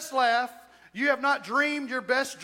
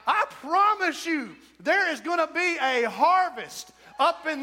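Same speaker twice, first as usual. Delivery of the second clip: fired-up promise; 6 semitones higher, 4 dB louder than usual. Shown as a demonstration. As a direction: lean into the promise, wound up. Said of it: "let me Ric Flair that".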